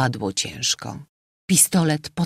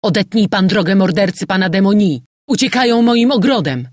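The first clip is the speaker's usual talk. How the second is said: loud, high, urgent commands; shouted orders; 5 semitones higher, 7 dB louder than usual. A narrator's reading. General sending the sergeant after the murderer, shouting. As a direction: shout to get the pursuit moving. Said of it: "wykrzyknął".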